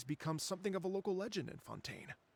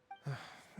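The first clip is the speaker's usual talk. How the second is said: soft breathy sigh; sigh, tired of the tale; a quiet weary exhale; 5 dB quieter than usual. Acted. Before long story.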